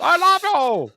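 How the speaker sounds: high mock love plea. Mimicking a character's love plea.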